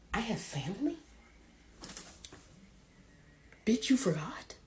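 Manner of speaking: sassy whisper. Acted. Psyche mock-indignant aside.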